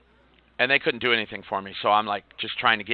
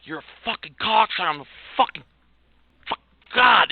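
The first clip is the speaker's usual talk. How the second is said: mock yell, higher and climbing to a loud final shout; retold furious outburst; 9 semitones higher, 5 dB louder than usual. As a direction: spit the insults out, losing it at the end. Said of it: "I just started screaming at him".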